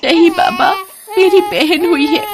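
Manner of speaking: high tearful reply. Acted. Girl telling her drunk father it's a girl, tearful and high. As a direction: say it through sobs, voice high.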